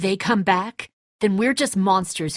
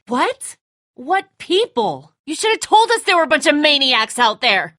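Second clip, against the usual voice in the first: high, loud accusing yell; angry shout; 9 semitones higher, 5 dB louder than usual. Acted. Father berating Lee for hiding the danger, angry yell. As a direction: hurl the blame at them. Furious at being kept uninformed.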